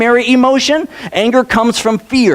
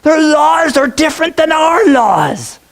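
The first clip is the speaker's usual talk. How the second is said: hammed-up warning to the king; a fearmonger's raised alarm; mock warning shout; 5 semitones higher, slower than usual. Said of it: "He says".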